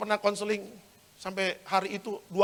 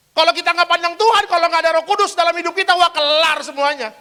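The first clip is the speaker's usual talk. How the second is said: loud, high, impassioned preacher; full-force preaching; 12 semitones higher, 14 dB louder than usual. Acted. Preacher urging the congregation to stay faithful to God, full-force preaching. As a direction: preach it out over the whole hall.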